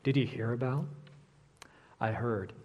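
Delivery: hushed, low, confiding teaser; conspiratorial gossip, with teasing pauses; gossip intonation. Shown as a demonstration. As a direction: drop low and soft, leaking a juicy secret. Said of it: "There's always this intonation like".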